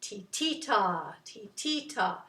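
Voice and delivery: slow 'titita', middle syllable accented; measured ti-TI-ta chant; push on second ti. Shown as a demonstration. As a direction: lean on the second 'ti', hold 'ta'. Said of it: "push on it".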